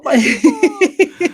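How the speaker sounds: stretched high and loud, laughing through it; drawn-out laughing cry. Real, not acted. Host gushing to her guest about fellow movie nerds, high laughing cry. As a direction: gush it out slowly, delighted.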